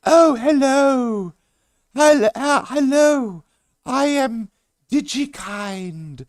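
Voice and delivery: high, slow, drawn-out put-on greeting; odd stranger voice. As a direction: stretch every word, high and strange.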